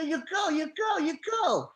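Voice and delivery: high-pitched mock nagging; hammed-up, high, repetitive protest; imitating the protesting woman. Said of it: "and this one go".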